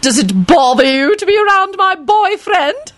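put-on very high, gloating old lady; delighted crowing. A narrator's reading. Lady Fanshawe teases about jealousy, crowing. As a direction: crow it in a high falsetto, delighted. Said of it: "she crowed delightedly".

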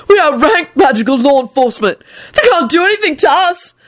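high-pitched jubilant boast. A narrator's reading. Boasting to his mate about the law officers, jubilant. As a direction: crow it in giddy triumph. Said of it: "Neville replied jubilantly".

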